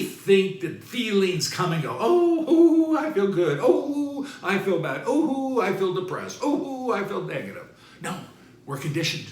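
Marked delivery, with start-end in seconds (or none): 2.0-7.7 high, loud, laughing mock sighs of feeling; mock mood swings. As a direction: parody someone tossed by every feeling. Mocking moody people's swings.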